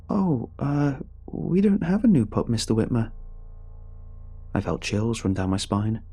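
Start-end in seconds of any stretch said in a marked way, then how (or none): 0.1-3.1 put-on much higher, startled young voice; startled boy. A narrator's reading. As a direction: blurt it out in surprise.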